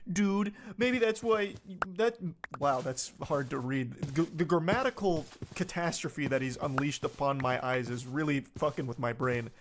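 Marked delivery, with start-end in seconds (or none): none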